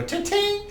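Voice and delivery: high-pitched imitated clink of steel; high sword clinks. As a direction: chirp it like blades ringing. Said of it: "take your sword and go".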